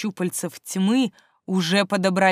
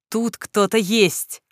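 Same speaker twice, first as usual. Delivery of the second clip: ordinary delivery, nothing marked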